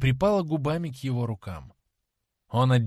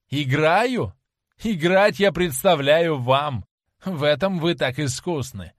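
slow, louder mocking reply; venomous; 3 semitones higher, 7 dB louder, slower than usual. Dorian mocks Sibyl's plea, venomous. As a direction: spit the words back with poison. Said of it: "ядовито ответил он".

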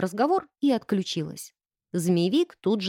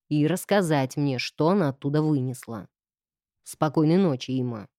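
low, strict master's voice; lower, stern instructing tone; 5 semitones lower than usual. Acted. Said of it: "другим строгим тоном".

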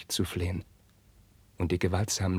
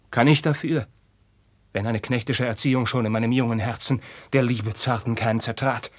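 higher, louder protest; higher, louder bitter outburst; impassioned; 3 semitones higher, 6 dB louder than usual. Acted. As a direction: defend yourself with passion. Carlos defends himself.

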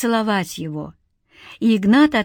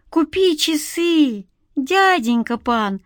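put-on high, slow begging child; whiny little street kid begging; pitiful boy; 7 semitones higher, slower than usual. A narrator's reading. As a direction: plead pitifully, stretching every word. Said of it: "жалостливо повторил пацан".

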